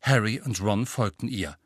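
ordinary delivery, nothing marked

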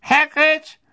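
high, thin, projected official's voice; loud high-pitched old official; old committee official. A narrator's reading. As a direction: speak up high and thin, advising.

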